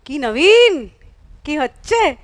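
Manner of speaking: high, loud nosy-neighbour call; loud Bengali auntie. Acted. Nosy auntie greeting her neighbour, loud Bengali auntie. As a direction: call out loudly with swooping pitch. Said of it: "loud Bengali auntie comes and says".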